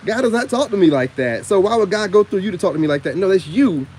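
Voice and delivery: loud, high, heated callout of preachy readers; venting at self-styled prophets, loud and high; indignant rant. Real, not acted.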